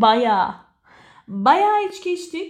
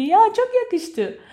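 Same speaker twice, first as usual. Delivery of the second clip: compliment; 11 semitones higher, slower than usual. Acted. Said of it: "به طرف برگردی بگی".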